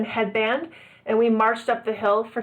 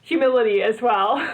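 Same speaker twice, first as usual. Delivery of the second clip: ordinary delivery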